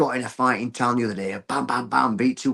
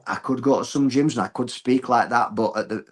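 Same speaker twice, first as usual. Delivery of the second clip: ordinary talk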